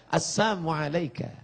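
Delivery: soft, sly greeting said with a sneer; whisper-soft salam with hidden mockery; soft mocking greeting. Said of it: "Bunyinya lembut". (salam lembut yang mengejek)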